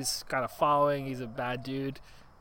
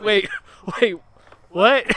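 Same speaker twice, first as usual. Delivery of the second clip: much higher, loud baffled cries; mock confusion; 5 semitones higher, 11 dB louder than usual. Stuck man calling after the runaway, loud baffled. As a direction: stammer in disbelief. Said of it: "the guy's like".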